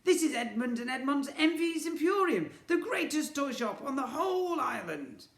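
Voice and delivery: very high loud boastful twin voice; boastful shopkeeper. Acted. Twin boasting to the boy about the shop, boastful.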